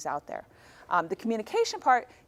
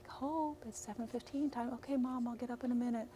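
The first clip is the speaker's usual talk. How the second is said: much softer, hushed wake-up call; gentle quiet wake-up; 3 semitones higher, 11 dB quieter than usual. Retold or imitated. Mom gently waking her introverted daughter. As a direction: coax her awake quietly. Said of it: "I wake my daughter up very quiet".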